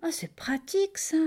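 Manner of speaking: pleasantly surprised, pitch leaping up; high, wondering approval; delighted. A narrator's reading. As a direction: marvel with delight. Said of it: "a dit le crocodile ravi".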